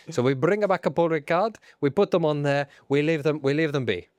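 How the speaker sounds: put-on comic Italian accent; Stefano Domenicali. Boss introducing himself to the public, Italian accent. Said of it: "I'm Stefano Domenicali".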